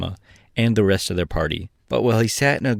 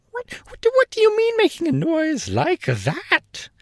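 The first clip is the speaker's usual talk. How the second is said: very high, sputtering angry king; very high, angry gnome king; angry shout. A narrator's reading. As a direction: splutter at the noisy hen. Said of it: "shouted the king angrily".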